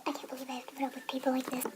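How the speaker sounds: quick, whispered grumble; rapid hushed aside; fed-up aside. Acted. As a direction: whisper it fast to yourself, exasperated.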